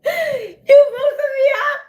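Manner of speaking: high, laughing disbelieving yell; cracking up and calling out; laughing shout. Real, not acted. Caught off guard by Jillian's comment.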